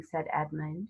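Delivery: usual speaking voice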